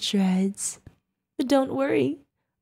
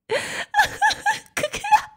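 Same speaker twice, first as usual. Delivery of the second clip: shrill, high, unhinged laugh; wild villain laugh; maniacal cackle. Acted. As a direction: let the madness out in a cackle. Villain mocking dead victims.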